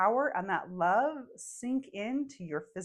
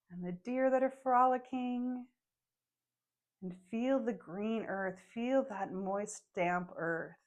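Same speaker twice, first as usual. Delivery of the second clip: guided meditation. Acted. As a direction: gently lead the listeners into the forest.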